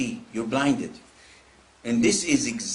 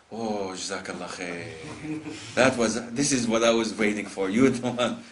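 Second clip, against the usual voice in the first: mock gratitude; 5 semitones lower than usual. Angry man imagined thanking his adviser, mock-grateful. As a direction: sigh out exaggerated, tender gratitude.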